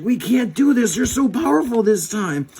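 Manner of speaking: cartoon heroes crying defeat; mock desperation. Rangers overwhelmed by the villains, mock desperate cry.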